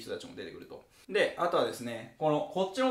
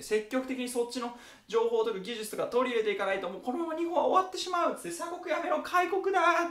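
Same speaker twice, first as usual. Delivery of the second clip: high, building to a cry; rally them to open the country; urgent plea; 8 semitones higher than usual. Retold or imitated.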